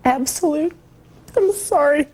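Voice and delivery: tearful, pitch cracking upward; fighting back tears; voice breaking. Real, not acted. Mother breaks down recalling children.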